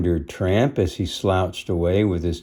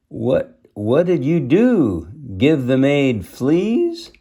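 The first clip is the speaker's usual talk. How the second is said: high, louder mocking dog voices, last line slow; higher, louder teasing pack of strays; joking dogs; 6 semitones higher, 4 dB louder, slower than usual. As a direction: rib her with a big grin. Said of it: "joked the others".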